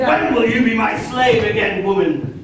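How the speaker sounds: loud, laughing mock master; hammed-up master calling his woman; playful mock command. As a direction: boom it out like a lordly master, laughing. Mock master teasing lover.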